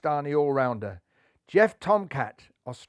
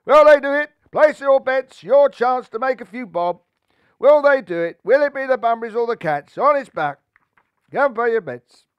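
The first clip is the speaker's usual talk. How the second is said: high, loud market-stall hollering; shouting bookie; 8 semitones higher, 12 dB louder than usual. A narrator's reading. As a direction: barker drumming up bets. Bookie touting bets.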